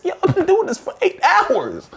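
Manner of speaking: high, pleading through laughter; whiny laughing complaint. Retold or imitated. Student whining to the teacher through laughter. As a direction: beg to stop, half laughing.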